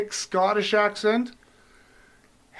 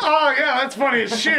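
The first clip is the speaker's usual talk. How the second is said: clueless self, laughing; 6 semitones higher, 3 dB louder than usual. Retold or imitated. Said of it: "and I'd be like".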